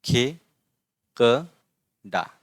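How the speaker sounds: slow, split into syllables; spelling out Khekra syllable by syllable. Shown as a demonstration. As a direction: sound out each syllable slowly. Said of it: "ये क्या है?".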